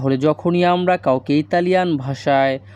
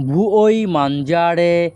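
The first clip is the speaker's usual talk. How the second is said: very slow, drawn-out syllables; slow Italian model; much slower than usual. Shown as a demonstration. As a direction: model the sentence slowly for students. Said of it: "এই বাক্যের Italian ভাষা হবে". (ধীরে ধীরে Italian উচ্চারণ)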